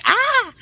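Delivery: sudden high yelp of fright; startled scream. Shown as a demonstration. Scared by ice sliding off the roof, high yelp. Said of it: "maybe even me being like".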